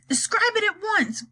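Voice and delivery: high, curt ground control order; commanding order. Acted. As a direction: bark it as a command. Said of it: "ordered ground control in Houston".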